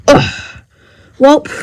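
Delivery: normal talking voice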